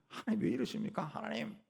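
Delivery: high-pitched protest without real volume; mock yelling. Believer demands why God does this, mock yelling. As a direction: imitate a squawking complaint to God, held back. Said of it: "소리 꽥꽥 지르면서".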